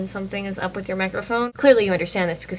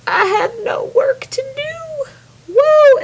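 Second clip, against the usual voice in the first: very high, drawn-out celebratory cheer; imagined elation; 7 dB louder, much slower than usual. Pretend. Imagining a friend free of schoolwork, mock jubilant squeal. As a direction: squeal it slowly, then whoop loudly. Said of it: "You'll be like".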